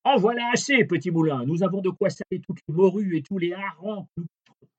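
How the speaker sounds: high, fast captain voice; captain satisfied. A narrator's reading. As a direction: rattle it off high and pleased. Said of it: "le capitaine lui dit".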